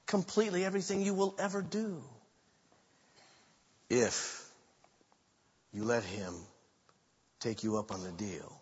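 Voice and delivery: preacher to the congregation, fading to a hush; fading appeal. Real, not acted.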